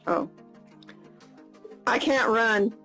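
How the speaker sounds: ordinary talk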